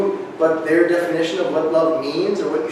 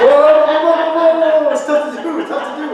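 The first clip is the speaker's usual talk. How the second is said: high, loud whoas while spinning round; dizzy spinning whoas; 10 semitones higher, 4 dB louder than usual. Real, not acted. Coach spins fast in class, calling out dizzily. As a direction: call out as you spin, losing balance. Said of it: "I'm spinning".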